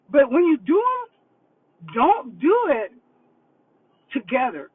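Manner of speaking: slow and loud, leaning on every word; dead serious warning. Real, not acted. Coach telling couples not to do boards together, slow and loud. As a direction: hammer the warning home, slowly. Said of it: "And I'm serious".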